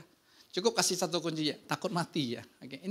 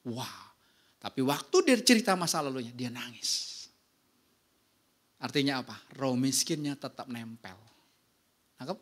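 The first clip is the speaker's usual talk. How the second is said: ordinary delivery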